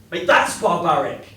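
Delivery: loud, sharp outburst; furious retort; angry shout. Acted. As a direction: spit it out in disgust.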